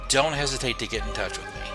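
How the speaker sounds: ordinary delivery, nothing marked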